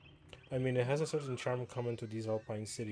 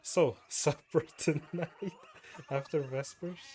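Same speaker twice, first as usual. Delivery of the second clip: slow, higher, laughing through the words; giggling while reading the line; breaking up with laughter mid-line; 5 semitones higher, 4 dB louder, slower than usual. Real, not acted.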